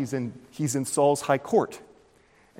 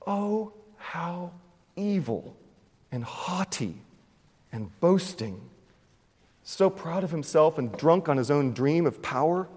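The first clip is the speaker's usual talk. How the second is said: lamenting scorn; 4 semitones higher than usual. Pretend. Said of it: "we want to say with David".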